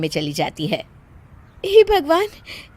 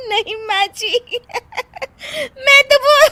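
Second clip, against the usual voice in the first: very high stifled giggles breaking into big laughter; helpless laughing. Acted. Daughter-in-law excusing herself to mother-in-law, laughing helplessly. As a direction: try to explain but dissolve laughing. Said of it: "फिर से हंस रही हो".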